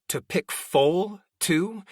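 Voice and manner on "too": ordinary delivery, nothing marked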